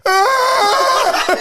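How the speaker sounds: loud, very high drawn-out cry; hammed-up punchline scream from a damaged child; wordless yell.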